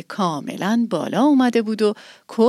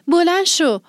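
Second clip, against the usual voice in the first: loud, much higher call of بلند شو with a falling end; 9 semitones higher, 4 dB louder than usual. Acted. Girl calling her sleepy cat to get up, loud and high. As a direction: shout بلند شو to rouse him.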